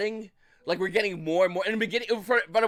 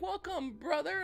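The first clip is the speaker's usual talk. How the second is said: high, soft welcoming voice, imitated; mock welcome; 12 semitones higher, 6 dB quieter, slower than usual. Players welcoming new people into the community, mock welcome. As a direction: greet a newcomer in a gentle high mock voice.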